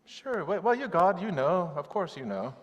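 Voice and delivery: high, hedging mock reply; nervous prophetic dodge. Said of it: "the prophetic dodge".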